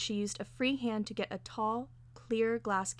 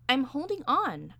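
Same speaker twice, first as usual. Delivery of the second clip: louder, higher, exasperated answer; insistent reply; 6 semitones higher, 6 dB louder than usual. A narrator's reading. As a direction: insist with raised voice. Answering her order under strain.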